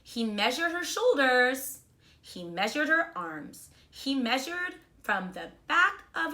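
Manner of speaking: put-on reading a storybook to children; bright, expressive read-aloud; kindergarten teacher voice. A narrator's reading. Teacher reads picture book aloud.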